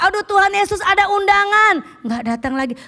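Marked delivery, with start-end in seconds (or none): 0.1-1.7 higher, louder whiny sigh of an excuse; mock lazy churchgoer. Member skipping church for an invitation, mock whiny.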